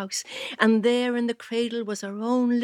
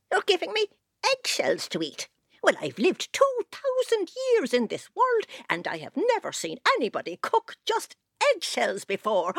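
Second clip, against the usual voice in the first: put-on high indignant old creature; outraged ancient fairy; 7 semitones higher than usual. Creature voice shifts.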